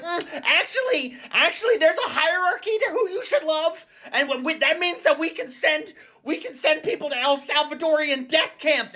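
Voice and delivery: high, smug nerdy voice; squeaky know-it-all lecturing about hierarchy; mocking J D Vance. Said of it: "J D Vance was like".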